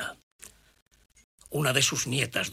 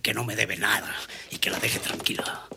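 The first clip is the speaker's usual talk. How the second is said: whispering. Acted. Bitter muttered reproach.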